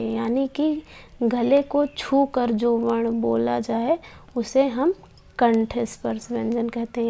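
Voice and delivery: ordinary talk, nothing marked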